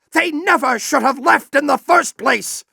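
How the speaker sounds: ordinary talk, nothing marked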